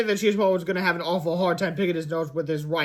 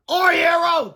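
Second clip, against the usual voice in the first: high, loud cheering shout; loud joyful shout; 12 semitones higher, 7 dB louder, slower than usual. A narrator's reading. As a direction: cheer the rescuer. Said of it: "the boy shouted".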